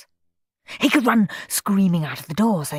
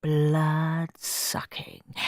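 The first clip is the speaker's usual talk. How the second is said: slow, softer drawn-out word; savouring it; 2 semitones lower, 5 dB quieter, much slower than usual. A narrator's reading. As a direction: roll the gruesome word slowly. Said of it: "savouring gruesome word".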